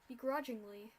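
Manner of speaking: usual speaking voice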